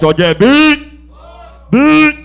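very high loud shout of beat; shouted cue. Real, not acted. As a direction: fire the cue at the crowd. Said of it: "Cuando yo diga beat". (grito agudo de llamada)

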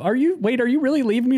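high, hurt pleading, played for laughs; mock panicked neediness; beaten partner. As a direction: plead like a partner being dumped. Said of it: "like a beaten partner in a relationship".

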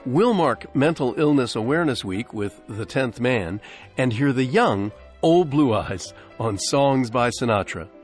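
usual speaking voice

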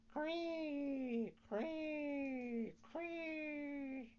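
high, thin cricket chirping. A narrator's reading. Cricket calling to Pinocchio, high chirping cree. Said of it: "someone in the room saying".

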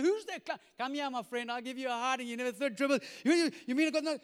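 loud mock-indignant grumbling; frustrated. Peter grumbles at the man on shore, frustrated. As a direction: rant and sputter at the stranger. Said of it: "he's frustrated".

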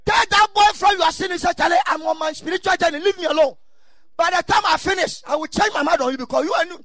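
loud preacher shouting; preaching shout. Real, not acted. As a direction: shout it from the pulpit. Preaching at full force.

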